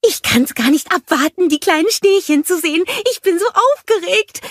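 high, eager snowman voice bubbling over; can barely wait to see them; excited snowman. A narrator's reading. Olaf climbing the stairs, bursting with excitement. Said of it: "rief Olaf".

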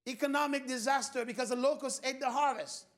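ordinary talk, nothing marked

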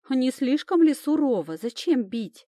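звонко возмущается, выше и громче; упрекни его за драку; возмущённо. Acted. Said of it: "возмутилась Камила". (Камила возмущена дракой эльфа, возмущённо)

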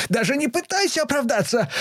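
very high furious interruption; cutting scream. A narrator's reading. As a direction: cut her off with a scream. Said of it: "снова прервала её Лиза".

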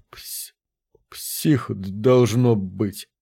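stuttering in shock, slow and soft; stammering. A narrator's reading. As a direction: stammer out the words, terrified. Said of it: "заикаясь".

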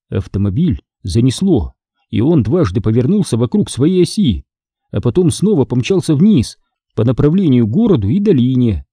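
usual speaking voice

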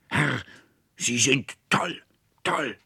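slow, high, forceful accusation; enraged shout. A narrator's reading. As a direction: fling each word out in rage. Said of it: "schrie der Konrektor voller Zorn".

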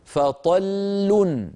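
فطل said very slowly with both lams sounded; slow pausal فطل with the doubled l; doubled lam held. Shown as a demonstration. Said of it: "جربوا".

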